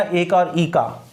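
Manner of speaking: ए and ई set apart slowly, का falling; contrast stress. Shown as a demonstration. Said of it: "देखो ए का".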